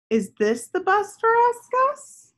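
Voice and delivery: put-on high, bright hopeful question, a child's voice; little girl hoping each vehicle is her bus; eager little girl. Girl Tess asks in story.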